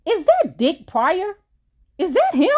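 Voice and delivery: high, incredulous in-your-face asking; prying stranger's question. Acted. Steve McQueen gets in the narrator's face, high nosy question. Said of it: "shoved his face straight into mine and asked".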